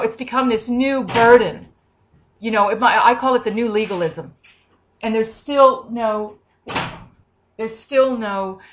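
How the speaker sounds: usual speaking voice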